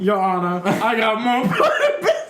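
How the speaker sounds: laughing Southern drawl; Southern lawyer drawl. Pretend. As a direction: plead to the judge, drawling. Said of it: "like a Southern lawyer".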